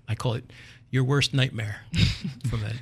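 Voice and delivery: ordinary talk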